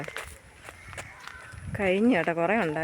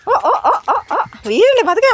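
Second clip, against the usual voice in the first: alarmed cry; 12 semitones higher, 7 dB louder than usual. Real, not acted. Toddler about to fall. (പേടിച്ച് ഉയർന്ന ശബ്ദത്തിൽ)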